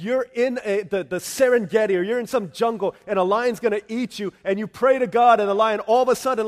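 loud, climbing preacher's cry; full-force preaching. Acted. Pastor pleading with the congregation, loud preaching. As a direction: preach it out over the music.

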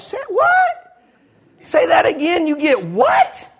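high, slow laughing shout of disbelief; can't believe what he just heard; disbelieving shout. Retold or imitated. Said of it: "too hard to believe".

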